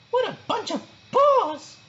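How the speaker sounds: high exasperated exclamation; a fed-up little huff; sighing Sparky. A narrator's reading. Sparky alone in the corner, exasperated. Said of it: "she sighed to herself".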